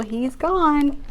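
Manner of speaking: ordinary delivery, nothing marked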